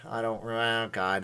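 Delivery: usual speaking voice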